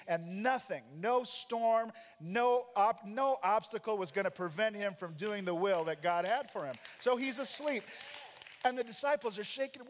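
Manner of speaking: ordinary delivery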